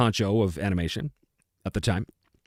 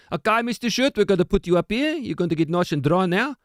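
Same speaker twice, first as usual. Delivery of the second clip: put-on higher-pitched South African accent; 5 semitones higher than usual. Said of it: "in a South African accent".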